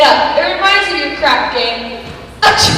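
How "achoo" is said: loud sneeze. Acted.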